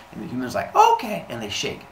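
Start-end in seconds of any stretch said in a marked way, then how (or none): 0.8-1.2 very high, loud squeak; hammed-up high "okay"; eager agreement. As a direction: squeak like an obedient cartoon character. Humans agree to deal.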